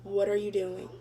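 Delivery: quiet, eerily calm question; calm but you know you're done for; soft, calm mom. Retold or imitated. Mom catching her daughter outside, scarily calm and soft. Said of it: "She was like".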